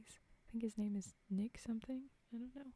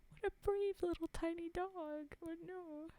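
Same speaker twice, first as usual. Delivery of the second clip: cooing; 10 semitones higher than usual. Real, not acted. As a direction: gush over an adorable tiny pet. Cooing over the story's little dog.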